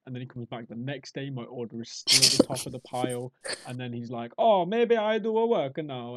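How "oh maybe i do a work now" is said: high, loud, slow mock Italian; Italian accent. Italian worker reluctantly starting work, slow mock Italian accent. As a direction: draw it out as a lazy Italian. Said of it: "I say Italian".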